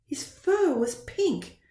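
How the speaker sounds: usual speaking voice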